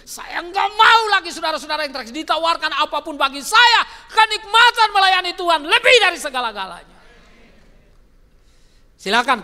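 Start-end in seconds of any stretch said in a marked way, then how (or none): 0.1-7.0 loud, high fervent preaching; louder fervent preacher's call; preaching at full force. Real, not acted. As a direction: drive it home to the church.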